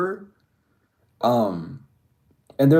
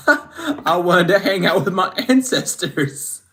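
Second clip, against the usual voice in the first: laughing; 5 semitones higher, 4 dB louder than usual. Real, not acted. Laughs while telling her ritual.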